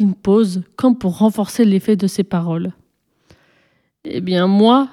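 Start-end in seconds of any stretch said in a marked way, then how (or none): none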